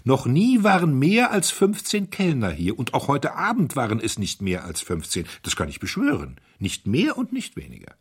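emphatic outburst, starting high and loud, settling; excited. A narrator's reading. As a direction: swear to it heatedly. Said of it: "rief der Herzog aufgeregt". (aufgeregt beteuernd)

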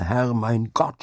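drawn-out cry to heaven. A narrator's reading. Husband praying for his dying wife, crying out. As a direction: cry it out slowly, in anguish. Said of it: "schrie er".

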